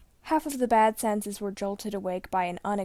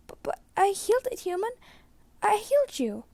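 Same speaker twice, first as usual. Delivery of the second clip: much higher, louder disbelieving plea; desperate young healer; 12 semitones higher, 3 dB louder than usual. A narrator's reading. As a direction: insist on the healing working. Blue refuses to accept death.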